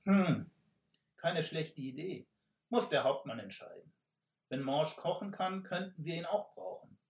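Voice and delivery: musing robber boss. Acted. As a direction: weigh it up like a crafty leader. Robber leader weighs idea.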